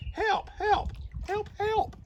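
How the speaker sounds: high, desperate cries of help; dog sinking in the well calls out, high desperate yell. A narrator's reading. The sinking dog yells up for rescue, frantic high cry. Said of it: "I yelled".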